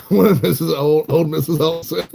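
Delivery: talking through real laughter; amused, laughing as you speak. Real, not acted. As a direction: let the giggle break up the words. Laughs while replying to viewer.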